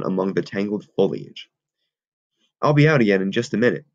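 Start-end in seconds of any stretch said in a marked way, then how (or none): 2.6-3.8 hurried. Acted. Fat boy crouching hurried.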